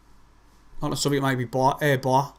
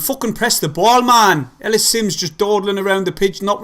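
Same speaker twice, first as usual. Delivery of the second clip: loud, raised pitch, frustrated; angry yell; 5 semitones higher, 3 dB louder than usual. Real, not acted. Fan yelling at Sunderland players to press the ball, angry and loud.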